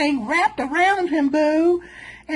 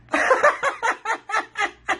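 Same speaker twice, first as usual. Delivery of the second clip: high cackling laugh; cackling at the man's odd body; real laugh; 6 semitones higher than usual. Real, not acted. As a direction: cackle at the drawing. Laughing at the drawing.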